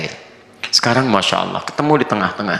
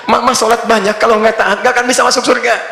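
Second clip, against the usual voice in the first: higher, louder teasing husband, laughing through it; husband coaxing his wife away from prayer, laughing; mock husband; 10 semitones higher, 4 dB louder than usual. Husband's excuse voiced, laughing.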